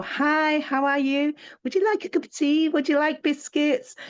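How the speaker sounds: high, sweet, quick hostess voice; bright hostess. Shown as a demonstration. Greeting a hostile visitor, bright and cheerful. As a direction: chirp it out like a welcoming host. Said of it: "you go".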